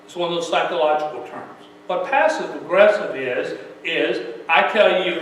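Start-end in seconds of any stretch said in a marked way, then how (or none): none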